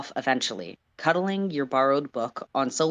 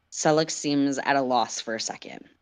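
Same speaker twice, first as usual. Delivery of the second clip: plain reading voice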